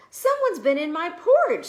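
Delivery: high and loud indignant bear; Papa Bear. A narrator's reading. Papa Bear finding his porridge eaten, loud indignant cry. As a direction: boom out the outrage. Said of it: "said Papa Bear".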